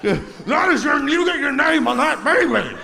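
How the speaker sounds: high, loud hammed-up rant; screaming angry guy. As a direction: imitate an irate man yelling in your face. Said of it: "in my face screaming".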